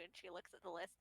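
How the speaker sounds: normal talking voice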